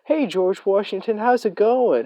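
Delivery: high, sing-song adult woman voice; chaperone's greeting. Retold or imitated. Chaperone greeting the student, high and cheery. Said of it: "She was like".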